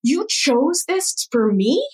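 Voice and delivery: slow, high, tearful retold question; retold tearful plea. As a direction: ask it through tears, slowly and high. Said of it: "crying and crying".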